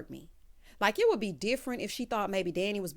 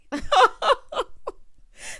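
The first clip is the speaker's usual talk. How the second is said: loud, high cackle; big laugh; 10 dB louder than usual. Real, not acted. Recapper laughing at own never-land joke, loud cackle.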